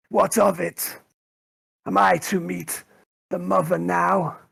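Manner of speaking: high-pitched, slow wretched mutant voice; Rufus. Acted. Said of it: "He speaks up and goes".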